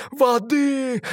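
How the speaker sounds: high, whining "Воды!"; capricious cry. A narrator's reading. Mermaid capriciously crying for water. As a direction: demand it like a spoilt child. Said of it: "капризно крикнула она".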